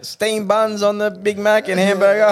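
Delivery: high, loud, drawn-out shout to the back; shouted kitchen order. Retold or imitated. Counter worker relaying a burger order to the back, shouting. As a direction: holler the order out slowly. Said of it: "you can just hear her shout".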